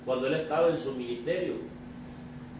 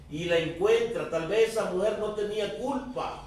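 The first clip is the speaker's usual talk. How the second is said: raised, emphatic sermon delivery; preaching; 5 semitones higher than usual. Acted. Preaches at full force. (predicación enérgica)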